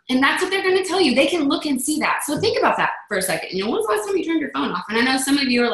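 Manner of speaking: ordinary delivery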